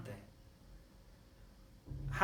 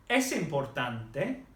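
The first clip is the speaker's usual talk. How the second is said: slow "es importante" rising at the end; questioning intonation; slower than usual. Shown as a demonstration. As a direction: put "es importante" as a clear question to a pupil. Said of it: "make it sound like a question".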